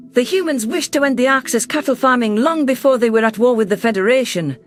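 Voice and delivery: ordinary delivery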